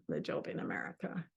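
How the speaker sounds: low, soft, unimpressed mutter; deflated. Real, not acted. Narrator flatly recalling the job in America, low and soft. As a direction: let it fall flat with doubt. Speaker's flat reaction.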